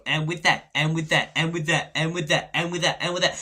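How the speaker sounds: mock voiceover line, over and over; repeated voiceover. Scrolling reel after reel, a repeated mock voiceover. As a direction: loop the dramatic opener mockingly. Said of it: "flicked to the next one".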